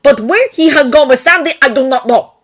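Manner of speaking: put-on a worried Santa in Russian accent. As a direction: Nick admitting he doesn't know, Russian accent. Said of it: "Nick agreed".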